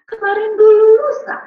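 put-on high, loud little-kid voice; retold child's answer. Child answers grandmother in retold story.

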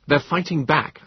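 high, eager battle report; caught up excitedly. A narrator's reading. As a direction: report it with a spark of war. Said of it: "caught up by the spark of war".

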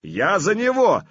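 high, loud defiant cry; shouted reply. Acted. Artyom takes his prince's place, shouted reply. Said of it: "выкрикнул Артём".

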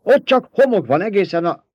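high, loud, affronted argument; indignant protest. A narrator's reading. Baron insists the south is only sand, indignant. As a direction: object heatedly, pitch pushed up. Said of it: "méltatlankodott a báró".

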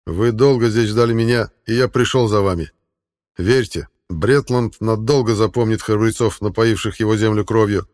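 loud, solemn address to warriors; rousing speech. Acted. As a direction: inspire the men. King addresses his warriors.

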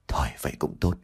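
low, soft sigh of relief. Acted. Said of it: "buông ra một câu thở dài".

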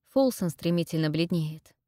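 usual speaking voice

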